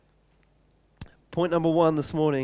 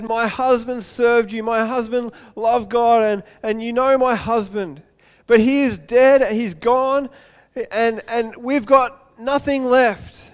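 widow's urgent plea, higher in pitch; crying out; 4 semitones higher, 3 dB louder than usual. Acted. Widow pleading with the prophet over her dead husband, crying out. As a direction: pour out a desperate account of loss. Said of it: "she cries out and says".

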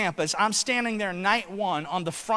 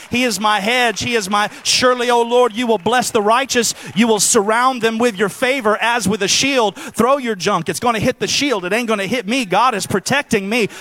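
louder fervent preaching; 6 dB louder than usual. Acted. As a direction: declare it with conviction. Preaching at full force.

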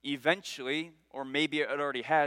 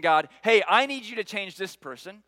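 demanding; 3 semitones higher, 7 dB louder than usual. Retold or imitated. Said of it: "David isn't saying".

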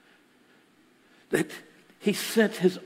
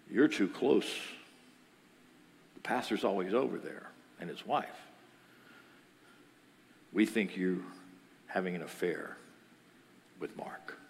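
low, soft, grave confrontation; hushed accusation; 9 semitones lower, 7 dB quieter than usual. Retold or imitated. Family confronting a young woman, hushed accusation.

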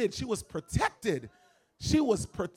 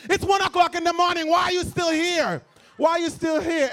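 loud, high Haitian mom scolding; mother with thick Haitian accent shooing the boyfriend home; Haitian mother's accent; 11 semitones higher, 9 dB louder than usual. Retold or imitated. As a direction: play the Haitian mother fed up at one in the morning. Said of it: "she's like".

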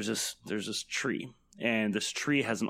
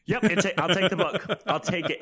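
eager game master; 7 semitones higher, 4 dB louder than usual. Retold or imitated. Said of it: "And I was like".

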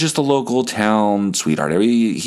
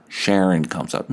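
usual speaking voice

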